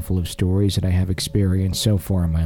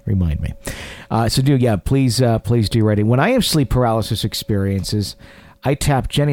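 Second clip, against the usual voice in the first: ordinary talk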